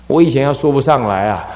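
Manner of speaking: ordinary talk, nothing marked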